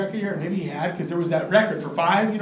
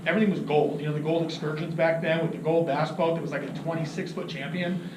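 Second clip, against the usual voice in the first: ordinary talk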